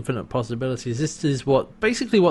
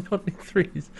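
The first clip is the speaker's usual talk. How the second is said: high, softer mock English accent; exaggerated British accent; 6 semitones higher, 6 dB quieter than usual. Imitating Harvey's card question, mock English accent. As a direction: echo it in a hammed-up British voice, softer. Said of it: "a very exaggerated sort of British accent".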